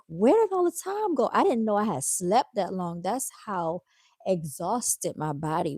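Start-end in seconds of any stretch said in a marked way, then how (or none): 0.1-1.3 retold surprise. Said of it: "And I'm like".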